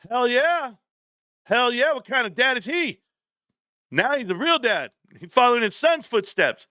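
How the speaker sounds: high-pitched, proud hype; hyped cheering. Real, not acted. Streamer cheers a viewer's dad's first investment, high excited hype. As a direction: celebrate it in a high, whooping voice.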